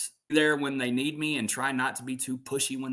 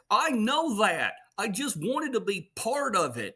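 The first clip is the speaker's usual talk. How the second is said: higher, slow, hurt cartoon dad; father answering his son, higher and slow with hurt; Goofy voice; 11 semitones higher than usual. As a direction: let the dad's hurt show, slowly. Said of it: "And Goofy says".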